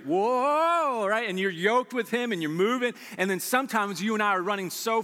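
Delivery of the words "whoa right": startled high whoa; surprised whoa. Retold or imitated. Listener startled as Jesus shifts to sixth gear, surprised. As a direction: jolt of surprise at the speed. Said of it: "You're like".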